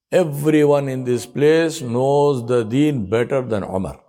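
slow, humble self-reproach; humble self-talk. Retold or imitated. Umar telling himself how humble he is, slowly. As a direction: draw it out as if talking to yourself. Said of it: "He's telling himself".

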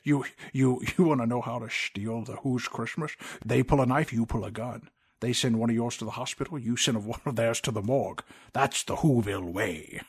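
lightly voiced Sean Connery Scottish burr; Sean Connery impression. Mimicking Jim Carrey's Grinch doing Sean Connery, mild Scottish accent. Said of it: "of Sean Connery".

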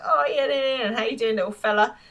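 put-on high, forced cheer to a baby; a lightly voiced fake baby greeting; fake enthusiasm. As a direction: put on a show of delight for the baby. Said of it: "it feels like fake enthusiasm".

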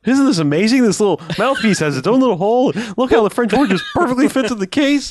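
high, loud, giddy gushing; hammed-up excited hitchhiker; mock wonderment. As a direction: marvel like a dazed enthusiast showing off. Imitating hitchhiker raving about his horn.

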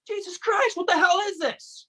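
exploding in disbelief at the counselor; upset outburst. Acted. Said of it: "Calm down".

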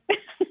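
bright embarrassed giggle; giggle, flattered and shy; high giggle. Real, not acted. Laughs at the compliment.

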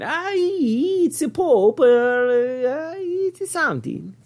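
high, drawn-out mock Italian accent. Host listing his Italian impressions, hammy Italian accent. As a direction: stretch the vowels in a silly high Italian voice. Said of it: "my apologies in advance to any of our Italian listeners".

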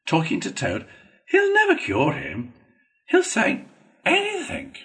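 scornful, climbing high on the last words; rising, exasperated contempt; contemptuous. A narrator's reading. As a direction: write him off with disdain. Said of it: "said Rat contemptuously".

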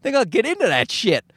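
high-pitched naive self-mockery; out-of-touch latecomer. Comic joining podcasting too late, high naive mockery. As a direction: pitch it up, cluelessly keen. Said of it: "I'm like".

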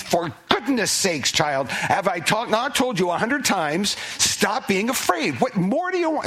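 mock exasperation, rising to a high peak; exasperated through clenched teeth. An exasperated God scolding a frightened child, through clenched teeth. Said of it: "is he saying with clenched teeth".

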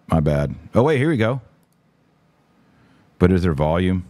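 normal talking voice

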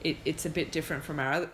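usual speaking voice

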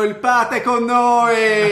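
loud, very slow, stretched introduction; announcer hype. Real, not acted. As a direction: announce him like a ring host. Announcing the returning guest.

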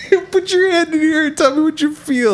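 high hammed-up haunted house host; mock spooky host. Host invites kids to feel the props, hammed-up spooky. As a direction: ham up a cheesy spooky guide. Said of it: "haunted house host".

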